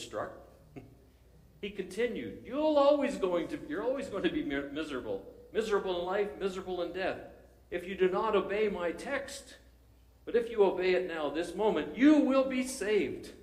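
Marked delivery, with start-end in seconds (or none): 2.4-13.3 hammed-up preacher pressing hard; pulpit warning. Preacher urging the stranger to obey, rising to a loud cry. Preacher warns the young man.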